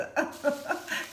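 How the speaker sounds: breathy laugh at her own joke; amused laugh. Real, not acted. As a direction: laugh after teasing. Rit de sa blague Rolex. (rire amusé)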